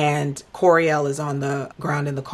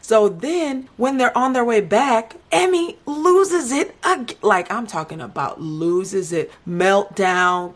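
ordinary delivery

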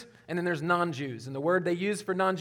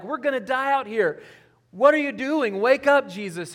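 panicked disciples; 5 semitones higher, 5 dB louder than usual. Retold or imitated. Disciples terrified in the storm.

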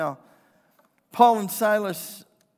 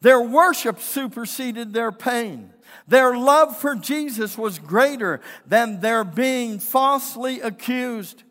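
emphatic preaching; 3 semitones higher, 7 dB louder than usual. Real, not acted. Preaches at full force.

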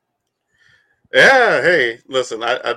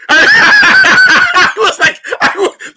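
a very high, loud, slow laughing yelp; real laughing shout; 11 dB louder, much slower than usual. Real, not acted. Cohost cracking up over being called a critter, loud laughing yelp. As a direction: break into a loud laughing squeal.

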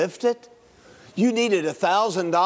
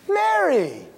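Mary higher, sharp fall; high calling Mary; 9 semitones higher than usual. Shown as a demonstration. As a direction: call out Mary in surprise. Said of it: "Think of all the ways he might have said Mary".

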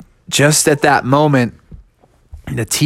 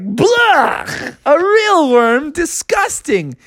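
disgusted bear; 13 semitones higher, much slower than usual. Acted.

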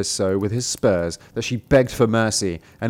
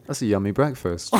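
usual speaking voice

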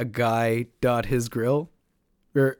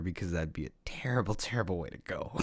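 whispered warning; 7 semitones lower, 13 dB quieter, faster than usual. Real, not acted. Sober warning after the joke.

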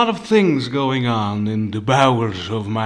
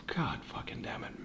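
exasperated whisper; 16 dB quieter than usual. Real, not acted. Sighing despair at cost.

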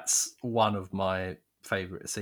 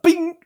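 imitating a sudden music sting, much higher and louder; loud high mock sting; 10 dB louder than usual. Friend imitating the movie's music cue with a sharp high burst. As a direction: blurt it out like a film's music cue. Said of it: "it's like".

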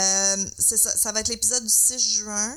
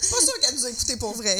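laughing; 3 semitones higher, faster than usual. Real, not acted. Joking while laughing.

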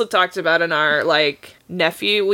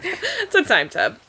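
laughing; 7 semitones higher than usual. Real, not acted. Laughing correction.

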